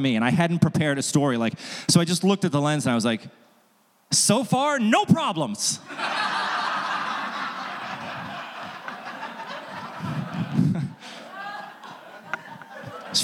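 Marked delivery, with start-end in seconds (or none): 4.1-5.8 high, cheerful mock-innocent reply; chirpy punchline. His own deadpan turn on camera. 5.8-11.1 big laugh. Real, not acted. Audience-side big laugh.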